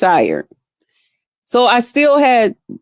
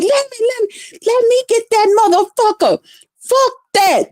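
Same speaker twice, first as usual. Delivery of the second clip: high-pitched mock yelling; retold screaming; 13 semitones higher than usual. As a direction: act out screaming in your sleep without full volume. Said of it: "I was screaming".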